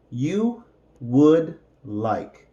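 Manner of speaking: "You would like" drawn out word by word; unhurried teaching pace; slow separated words. Shown as a demonstration. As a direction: model "You would like" slowly for learners.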